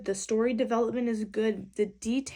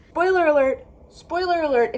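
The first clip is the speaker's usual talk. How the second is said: shouted spoiler warning; yelling a warning to viewers; loud warning; 5 semitones higher, 9 dB louder than usual. Real, not acted. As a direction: call it out loud and high. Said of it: "because I'm upset".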